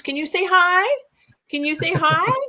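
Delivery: imitating a high singsong coaxing call; llamada persuasiva. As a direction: coax a shy creature to answer. Coaxing unseen beings, retold.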